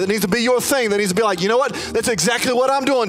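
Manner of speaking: preacher at full force over music; full preaching. Acted. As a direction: lift the room, pressing on. Preaching climax to the room.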